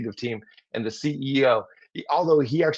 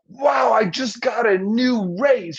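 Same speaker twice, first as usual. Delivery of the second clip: higher, louder, slow, thrilled announcement; excited news; 9 semitones higher, 4 dB louder, slower than usual. Shown as a demonstration. Coworker announcing a raise, excited and loud. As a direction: burst in with big news. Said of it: "in the tone of what they're saying".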